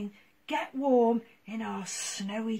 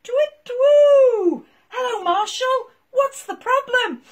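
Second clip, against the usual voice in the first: high loud hooting owl; 12 semitones higher, 14 dB louder than usual. Acted. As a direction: hoot like a friendly owl. Voices the Owl.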